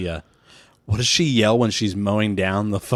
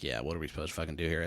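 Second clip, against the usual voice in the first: quick mock reply; 5 semitones lower, 14 dB quieter, much faster than usual. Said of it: "they're like".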